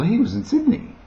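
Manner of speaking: ordinary talk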